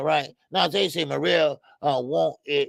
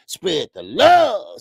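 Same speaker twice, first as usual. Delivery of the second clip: drawn-out shout-out; 4 semitones higher, 8 dB louder, much slower than usual. Real, not acted. Streamer hypes up a viewer's message, slow and loud. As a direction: stretch it slow and loud, a little higher.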